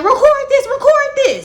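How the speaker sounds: high, loud, hyped-up command; excited urging. Retold or imitated. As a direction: shout it up high, urgent and thrilled. Friend urgently demanding filming.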